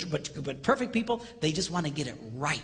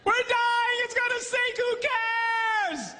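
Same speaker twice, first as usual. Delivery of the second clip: very high, loud hysterical cry; hammed-up terrified scream; mock panic; 3 dB louder than usual. Titanic passenger pleading as the ship sinks, shrieking mock panic.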